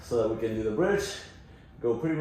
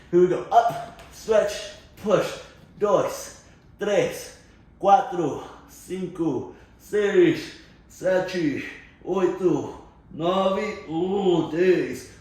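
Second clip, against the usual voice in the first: loud rep counting; 5 semitones higher, 5 dB louder, much slower than usual. Real, not acted. Coach calling out the reps.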